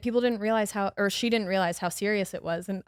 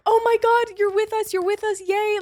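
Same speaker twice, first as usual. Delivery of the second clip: high, bright cheering, much higher pitch; excited relief; 11 semitones higher, 5 dB louder than usual. Retold or imitated. Flight attendants cheer the revived woman, high and excited. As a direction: celebrate that she is back with us.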